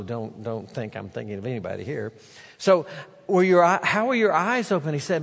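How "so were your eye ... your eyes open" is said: eager questioning. Acted. Neighbors demand answers.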